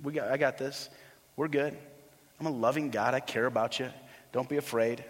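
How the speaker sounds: soft, low, easy reassurance; gentle, unhurried comfort as Jesus; compassionate. Acted. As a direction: soothe a frightened friend casually. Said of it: "Jesus is so compassionate".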